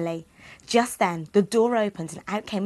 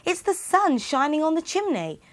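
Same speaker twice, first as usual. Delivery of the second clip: stepmother; 8 semitones higher than usual. Acted. Stepmother voiced.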